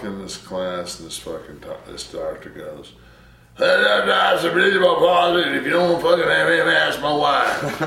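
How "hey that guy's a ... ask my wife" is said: quoting the professor, much higher and louder, laughing at the end; booming teacher joke. Retold or imitated. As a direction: belt out the teacher's crude joke to the whole class. Teacher's joke quoted in class.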